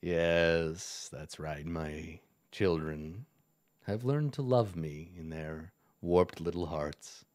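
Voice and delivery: low, resonant villain baritone; a hint of a deep goblin king. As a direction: roll it out low like a smug lord. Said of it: "resonant baritone voice".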